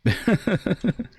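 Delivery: put-on high squealing baby noise. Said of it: "Or your baby voice".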